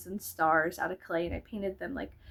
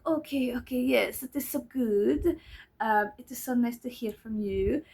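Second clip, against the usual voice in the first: imitated South African accent. Psychiatrist pleased with patient's news, imitated French-sounding accent. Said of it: "his accent".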